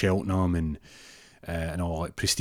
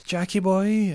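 put-on old lady voice; 10 semitones higher than usual. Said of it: "She goes".

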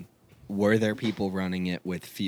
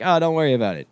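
breezy reassurance; 8 semitones higher, 8 dB louder, faster than usual. Retold or imitated. Stripper reassures nervous volunteers.

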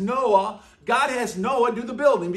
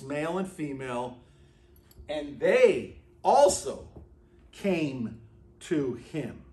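solemn scripture reading; 4 semitones lower than usual. Acted. Scripture read slowly.

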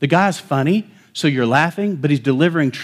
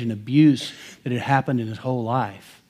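ordinary talk, nothing marked

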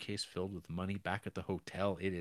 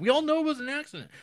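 frantic reassurance; 8 dB louder, much faster than usual. Retold or imitated.

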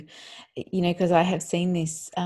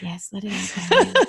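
sugary soothing, darling swooping way up; mock soothing parent; 5 dB louder than usual. Parent mockingly soothing the angry child, sugary. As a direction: overdo the gentle parent. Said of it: "I'd be like".

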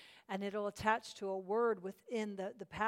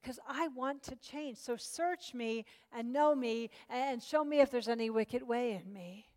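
prayer; 3 semitones higher than usual. Real, not acted. Opens with a spoken prayer.

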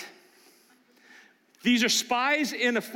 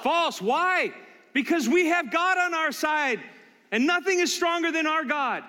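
emphatic declaration; 9 semitones higher than usual. Real, not acted. Preacher answers his own question.

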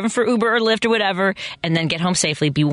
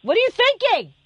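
high-pitched playful scolding; mock-outraged telling-off; mock yelling. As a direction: pretend to yell at a friend, pitch shooting up. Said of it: "I'm gonna yell at you".

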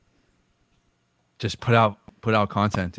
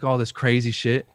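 ordinary delivery

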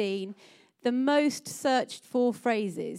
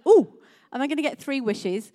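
excited; 4 semitones higher, 8 dB louder than usual. Acted. Woman's eager wish in joke.

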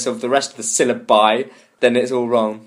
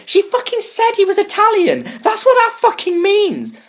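much higher, incredulous and ranting; exasperated rant. Real, not acted. Ranting to co-hosts about a dumb comment, high and incredulous. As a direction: explode at the commenter's stupidity.

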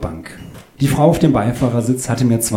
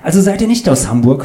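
high, louder curious question; nosy passenger; 7 semitones higher, 5 dB louder, faster than usual. Acted. Claudius presses the couple about Hamburg, high and eager.

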